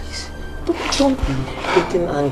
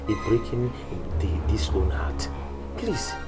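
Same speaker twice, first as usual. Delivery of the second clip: whispered plea; 6 semitones lower, 7 dB quieter than usual. Acted. Pleading softly with Pino.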